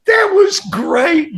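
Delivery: high, drawn-out delight through laughter; real laughing outburst; laughing exclamation. Real, not acted. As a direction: crack up while exclaiming, pitch way up. Laughing at own punchline.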